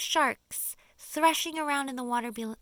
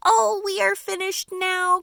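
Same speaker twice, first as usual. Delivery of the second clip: high, loud cry of despair; a spider crying out in panic; 10 semitones higher, 12 dB louder than usual. Acted. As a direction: wail that all is lost. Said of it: "cried Miss Spider".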